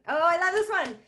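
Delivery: loud, high burst of delight; excited. Real, not acted. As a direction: gush with geeky excitement. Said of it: "I get so excited".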